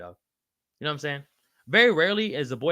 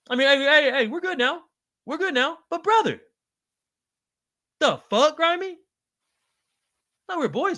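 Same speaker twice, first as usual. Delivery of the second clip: disbelief; 13 semitones higher than usual. Real, not acted. Stunned, half-joking at friend.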